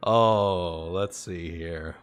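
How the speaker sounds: ordinary talk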